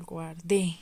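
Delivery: guardé drawn out with weight on the final -DÉ; guar-DÉ, final é stressed; stress on final syllable. Shown as a demonstration. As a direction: say guardé slowly, landing hard on the last syllable. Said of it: "se escucha más fuerte? Al final".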